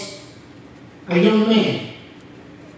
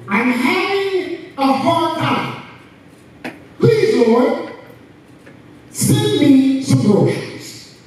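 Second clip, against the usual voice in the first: high, halting plea to God; imitated shouted prayer; 7 semitones higher, slower than usual. Old woman praying on her porch for food, imitated shouted plea. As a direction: cry out each phrase high, pleading. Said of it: "and she shouted".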